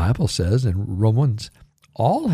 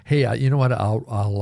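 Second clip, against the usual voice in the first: ordinary talk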